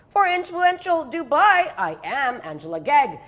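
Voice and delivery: slow, drawn-out host sign-off; presenter sign-off. Acted. Host closing the episode, slow presenter sign-off. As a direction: announce the show name like a TV host.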